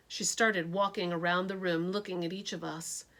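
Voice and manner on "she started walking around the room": plain reading voice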